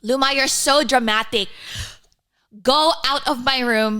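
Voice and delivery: put-on loud, high Filipino accent, mum dismissing her; Filipino-accented mum scolding loudly. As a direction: imitate a Filipino mum shooing her daughter away. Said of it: "in her accent".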